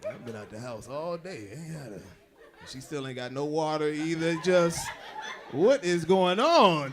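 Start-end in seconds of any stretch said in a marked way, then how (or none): 0.1-2.0 pitiful hungry murmur. Retold or imitated.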